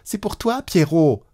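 high coaxing voice to the dog; a mild high call to a dog down a well. Acted. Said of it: "Elles avaient beau spécifier".

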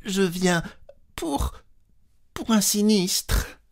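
a high, nervous stammer that catches. Acted. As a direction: trip over the words in panic. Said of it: "Elle bégaya".

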